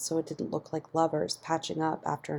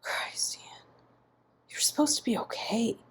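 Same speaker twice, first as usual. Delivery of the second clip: breathy, hushed and pained; Mickey whispering his despair to Ian; anguished whisper; 7 semitones higher, 3 dB quieter than usual. A narrator's reading.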